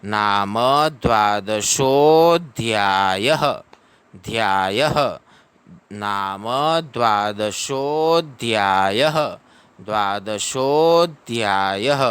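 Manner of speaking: slow "द्वादशोऽध्यायः" with a long sho and echoed ha at the end; held-sho demonstration. Shown as a demonstration. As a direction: stretch sho and dhyaa in "द्वादशोऽध्यायः". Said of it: "ऐसे पढ़ा जाएगा".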